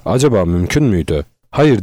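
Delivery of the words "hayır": ordinary delivery, nothing marked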